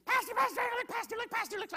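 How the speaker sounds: excited calling out. Retold or imitated. Preacher imitating a woman running up after the service, high excited calls. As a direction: call out like a woman rushing up to the pastor. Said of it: "She's screaming".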